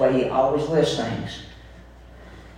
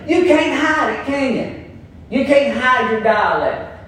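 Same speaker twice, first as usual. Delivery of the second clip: ordinary talk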